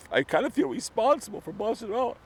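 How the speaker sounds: high, loud, hammed-up blubbering; mock crying. Mourning a dead hater on camera, hammed-up mock sobbing. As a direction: wail it out like fake sobbing grief. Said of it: "These aren't crocodile tears, they're real tears".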